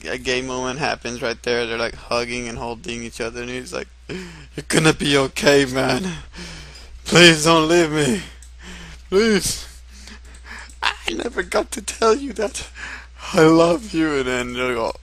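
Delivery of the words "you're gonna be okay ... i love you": pleading, rising into a high voice; hammed-up deathbed pleading; melodramatic farewell. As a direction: beg a dying friend to stay, overacted. Acted tearful farewell to friend.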